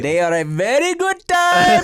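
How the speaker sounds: mock-excited boast about Australian women; hammed-up leer. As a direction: oversell it with a knowing leer. Friends riffing on Australian women.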